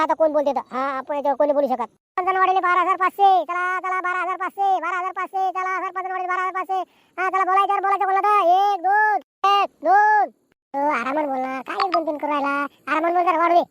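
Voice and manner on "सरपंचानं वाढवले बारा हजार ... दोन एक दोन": chanted bid with stretched count; auctioneer's call. Acted. Auctioneer announcing the sarpanch's raise, calling out.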